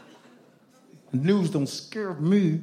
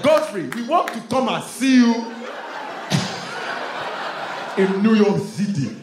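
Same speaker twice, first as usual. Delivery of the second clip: put-on loud, eager Nigerian accent; a light Nigerian accent; 8 semitones higher, 4 dB louder than usual. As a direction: call out like visiting Nigerian cousins. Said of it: "Not with that accent".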